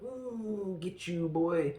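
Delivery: hammed-up chase call, starting high; mock taunt. Acting out Bond chasing henchman.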